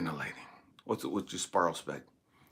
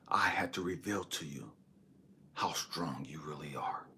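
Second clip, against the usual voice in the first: God. Retold or imitated. God revealing strength to the man who feared dying, hushed. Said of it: "And God had to show me".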